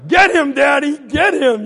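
high, loud gleeful cheer; whooping it up. Acted. Kid egging on dad from downstairs, whooping. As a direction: cheer him on noisily. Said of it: "I'm just really whooping it up".

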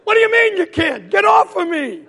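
high, loud incredulous shout; outraged yelling. Acted. Kid demanding brother get off him, high outraged yell. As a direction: shriek in comic outrage.

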